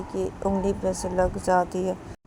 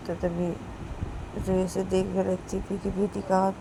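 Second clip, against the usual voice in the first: ordinary delivery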